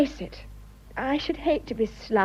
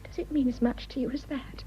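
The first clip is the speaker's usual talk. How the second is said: ordinary talk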